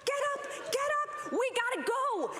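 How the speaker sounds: high, urgent call to get everyone out; urgent rally. Retold or imitated. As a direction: hurry the kids out like there's a fire. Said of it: "as though there was a fire".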